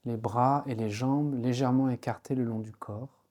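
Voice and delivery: ordinary delivery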